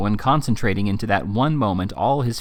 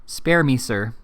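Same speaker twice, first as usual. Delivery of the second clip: plain reading voice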